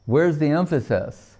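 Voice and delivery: ordinary talk, nothing marked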